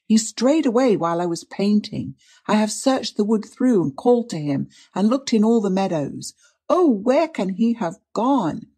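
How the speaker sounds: put-on high, plaintive young woman's voice; distressed, catching breath. A narrator's reading. Niece explaining how she lost Freddie, distressed. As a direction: plead in rising distress. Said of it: "in a faint voice and with a catch in her breath".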